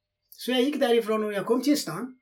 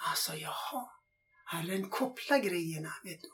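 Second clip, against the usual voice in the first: whispered aside; 10 dB quieter than usual. Real, not acted. Amazed aside.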